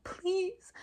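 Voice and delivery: high, drawn-out mock plea; imitated begging. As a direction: plead it, re-enacting the desperation. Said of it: "I was literally begging them".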